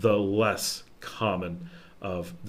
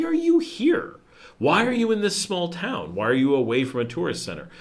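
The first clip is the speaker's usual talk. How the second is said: puzzled curiosity; 7 semitones higher than usual. Retold or imitated. Imitating curious small-town locals asking a visiting American, with high baffled surprise. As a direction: pitch leaps way up in mock surprise.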